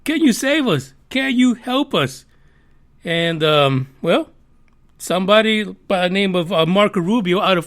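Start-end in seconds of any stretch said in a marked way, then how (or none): 0.0-2.2 higher, begging for rescue; hammed-up helpless plea; mock pleading public. The public begging the government for help, mock pleading.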